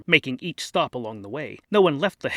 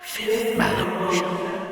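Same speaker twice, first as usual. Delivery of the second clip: much lower, slow announcer call; floor announcer; much slower than usual. Acted. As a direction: call out the floor, deep and drawn out.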